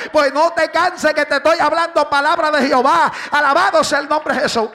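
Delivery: shouted, driving sermon at full volume; full-force preaching. Acted. Pastor shouting his sermon to the church, full force. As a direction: preach it hard to the congregation.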